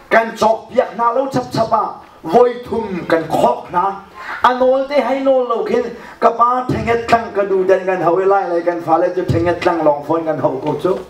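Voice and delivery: ordinary delivery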